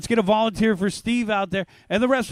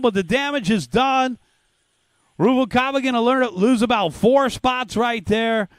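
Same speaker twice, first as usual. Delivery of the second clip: play-by-play; 3 semitones lower than usual. Acted. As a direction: call the race live over the PA. Live race play-by-play.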